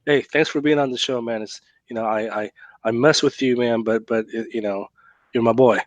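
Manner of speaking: ordinary talk, nothing marked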